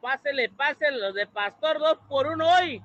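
loud, high street vendor call; hyped fairground barker. Acted. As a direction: hawk tacos to passersby, high and loud. Calling customers like taco vendor.

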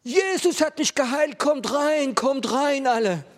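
very high, loud shouted joy; ecstatic scream. Retold or imitated. Healed Mary shouting to the football crowd, ecstatic scream. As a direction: shout it out across the pub in delight. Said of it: "ich hör's von weitem schreien".

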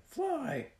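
put-on very high, squeaky baby bird; little bird voice. A narrator's reading. Blaze the firehawk says her first word, tiny high squeak. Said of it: "Blaze said".

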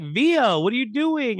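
high, loud indignant Villa, what are you doing; mock outrage. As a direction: buddies hollering in disbelief. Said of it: "his buddies are like".

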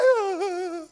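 high, loud comic wail; mock freezing cry. Man stepping onto freezing balcony, mock shivering wail. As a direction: cry out as if hit by cold.

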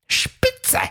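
very high, delighted shout; joyful cry. A narrator's reading. Fred cheers that Theo agrees to race, joyful. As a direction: burst out with anticipation. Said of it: "rief Fred voller Vorfreude".

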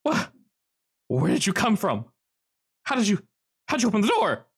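high, startled man's voice; a jittery prisoner caught off guard; nervous trapped man. Acted.

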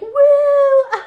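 high loud whooping cheer; crowd cheer. Retold or imitated. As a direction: whoop like a wild audience. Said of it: "They're like".

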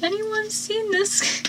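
high, soft, slow mock question; mimicked clueless question. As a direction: draw it out high and softly, imitating him. Mimics Cliff's clueless entrance.

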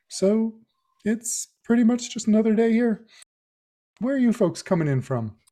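put-on high, friendly old country doctor. Frank greets Rosemary in the cold, high friendly old man's voice. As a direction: play a cheery older doctor greeting newcomers.

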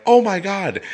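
high-pitched panicked exclamation, imitated; mock alarm. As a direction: imitate a nurse freaking out. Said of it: "to stop the nurse, like, from being like".

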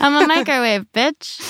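sassy appliance, punchline delivered loud; cheerful talking appliance. Retold or imitated. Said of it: "repeats the microwave line".

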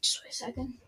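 whispered aside. Real, not acted. Vlogger steps away for the harmonica, whispering. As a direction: whisper it under your breath. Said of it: "steps away to get harmonica".

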